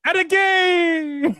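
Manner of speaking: high drawn-out cheer; excited cheer. Pretend. Cheerleading call.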